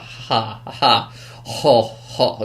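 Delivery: deliberate, spaced-out fake "ha, ho" laugh. Fan imitating the film villain, fake laugh. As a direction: imitate a forced laugh, one syllable at a time. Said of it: "doing that fake laugh".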